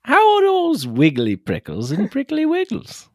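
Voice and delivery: put-on high, slow, dreamy old philosopher; Alan Watts impression. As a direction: float up into a tipsy sage voice. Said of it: "he'll be like".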